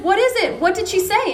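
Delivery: acted-out urgent questioning; retold alarm. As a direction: imitate a husband demanding to know what happened. Husband answers wife's alarm.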